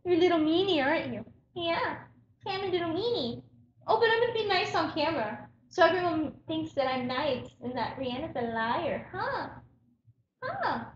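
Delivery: high soft cooing pet voice; baby talk. Acted. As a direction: coo at the rat.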